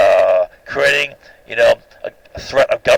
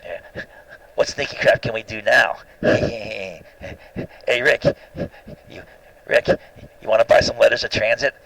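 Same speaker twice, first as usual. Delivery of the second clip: lightly voiced sneaky schemer, soft and hushed; a hint of a wheedling Peter Lorre, half under the breath; mild hushed conspirator impression; 5 dB quieter than usual. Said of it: "speaking in a Peter Lorre type voice".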